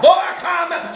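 high shouted brag; hammed-up climber bragging; mock boast. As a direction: crow it like a proud fool. Mocking proud climber.

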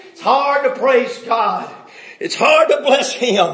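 rising preaching. Acted.

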